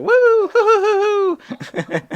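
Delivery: long, high, loud woo-hoos; goofy whooping. Retold or imitated. Comic recalls his act for stoned crowd, goofy whooping. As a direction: whoop like a goofball, breaking into a laugh. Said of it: "making goofy sounds".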